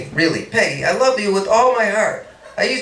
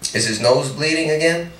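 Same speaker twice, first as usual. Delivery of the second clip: ordinary delivery, nothing marked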